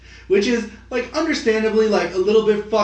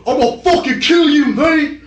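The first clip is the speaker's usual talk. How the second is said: put-on higher, louder British-accented threat; mock British threat; 9 semitones higher, 5 dB louder than usual. Mocking the English brothers' trash talk, British accent. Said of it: "they're like".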